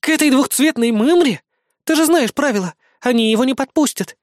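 high-pitched, agitated objection; indignant protest. A narrator's reading. Goddess protests the choice. (возмущённо, высоким голосом)

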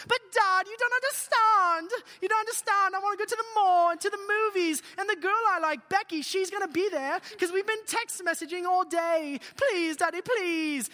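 high, loud mock teen pleading; whiny British-style complaint. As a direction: whine at Dad in protest. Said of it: "I hear it in a British voice".

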